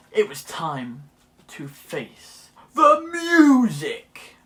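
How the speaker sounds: big drawn-out build to a booming finish; hammed-up TV talent show announcer; X Factor announcer voice. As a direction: announce it grandly, stretching the last words loud. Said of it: "I can't do the voice".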